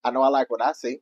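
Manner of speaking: low, soft flirtation; flirty murmur. Real, not acted. Flirting with the co-host.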